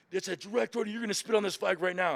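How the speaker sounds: high, loud retold command; insistent drill instructor. Other drill instructors press the recruit, insistent command.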